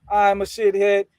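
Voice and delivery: rally the gang, finish on a high shout; playful chanted cheer; rallying roll call. Pretend.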